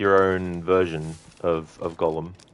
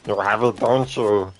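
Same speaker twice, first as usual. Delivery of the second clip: higher, louder creature voice, dragged slowly; Arnie Gollum; 5 semitones higher, 5 dB louder, slower than usual. Shown as a demonstration. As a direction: whine after the lost precious, then accuse. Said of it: "Arnie Gollum".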